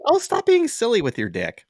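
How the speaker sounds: high, loud playful scolding; mock-innocent telling-off; imitated innocent woman.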